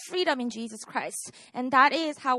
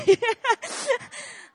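high, embarrassed laugh at her slip; a bashful giggle; sheepish laugh; 10 semitones higher, 4 dB louder than usual. Real, not acted. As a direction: laugh at your own mistake.

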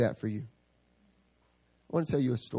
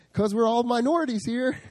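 high, louder wisecrack as Zach; joking friend; 10 semitones higher, 4 dB louder than usual. Retold or imitated. Zach cracking a joke to the group, high and louder. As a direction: toss off the joke in a higher voice. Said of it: "then I think Zach was like".

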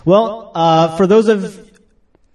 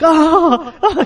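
high mock wail of distress; freaking-out cry; 11 semitones higher, 3 dB louder than usual. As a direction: imitate someone freaking out at a video. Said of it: "he's, like, freaking out".

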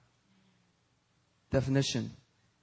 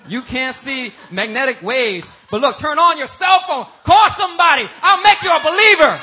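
full-force preaching; 12 semitones higher, 16 dB louder than usual. Real, not acted. Preacher proves unseen power to congregation, shouted preaching. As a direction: build from loud, high preaching to a full shout.

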